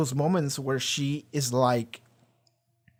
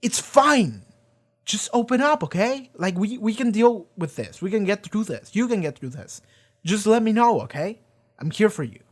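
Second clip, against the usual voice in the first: higher, gentle coaxing reassurance; a friend urging the Doctor to share, pitched up and reassuring; 5 semitones higher, 3 dB louder than usual. Retold or imitated. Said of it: "somebody has to tell her".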